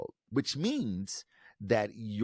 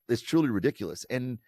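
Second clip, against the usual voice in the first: ordinary talk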